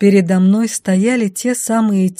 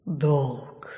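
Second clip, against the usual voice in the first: soft, low sighing word; quiet sigh; 4 semitones lower, 8 dB quieter than usual. A narrator's reading. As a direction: breathe it out like darkness. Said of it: "тихо вздохнула тьма". (тихий вздох тьмы)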